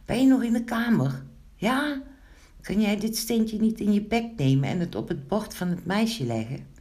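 high-pitched, eager request; eager calling. A narrator's reading. Gnome asks Wiebelstaartje to carry the stone, eager calling.

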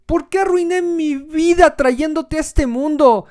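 put-on a child wailing to his mother; whiny crying kid. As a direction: whimper it like a sulky little kid.

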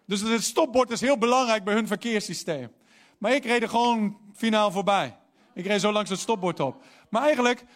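ordinary talk

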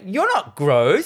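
high, slow, drawn-out retort; mock anger. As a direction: act out an indignant outburst. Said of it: "and gets all angry".

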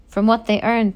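plain reading voice

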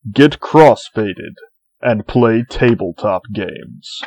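swelling trailer-style narration; epic fantasy narrator. Acted. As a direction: build it up like a movie trailer. Mock epic intro continues.

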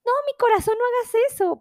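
high, sweet plea to her husband; tender high-pitched plea to husband; sweet pleading. Retold or imitated. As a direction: coo it up high like scolding a loved one. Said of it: "Y yo".

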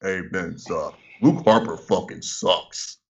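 low, quiet, slow wrestler grumble; Randy Orton. Retold or imitated. Podcaster imagining a wrestler privately complaining to the boss, low and slow. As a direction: say it low and unhurried, under the voice. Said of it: "Orton was probably like".

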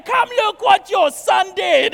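loud, high, slow mock outrage; horrified mom yelling to summon dad. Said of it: "she, like, is horrified".

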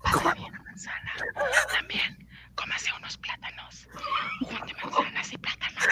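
whispered advice; whispering. Real, not acted. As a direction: whisper it, almost no voice. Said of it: "pienso que hablo muy fuerte".

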